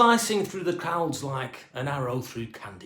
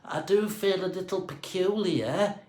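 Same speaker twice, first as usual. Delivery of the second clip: dazed thought; 4 semitones higher, 4 dB quieter, slower than usual. A narrator's reading. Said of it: "he thought".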